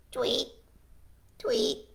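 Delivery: small, neat chirped words; light storybook tweet; bird tweeting. Acted. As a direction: imitate a little bird. Voices pig imitating birds.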